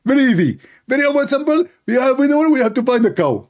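high-pitched, emphatic put-on voice; insistent, high mock-divine instructions; Allah. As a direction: hand down orders in a comic high voice. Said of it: "Allah, he answered".

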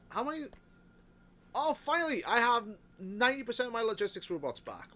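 high, loud, delighted outburst; excited. Real, not acted. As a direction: cheer at the robots coming back. Robots finally return.

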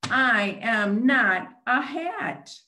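slow, firm protest; grumpy cake. Acted. Cake puppet slowly insisting to the cyclops it isn't a hat. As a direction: insist flatly, word by word.

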